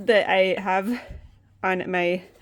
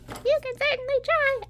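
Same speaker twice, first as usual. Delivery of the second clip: much higher, smug little dragon voice; voicing the plushie; 14 semitones higher, 3 dB quieter than usual. The dragon plushie replying to her threat, smug and squeaky. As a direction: answer back as the cheeky plushie.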